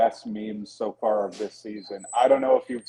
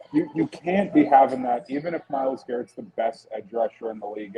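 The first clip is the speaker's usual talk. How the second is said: ordinary talk, nothing marked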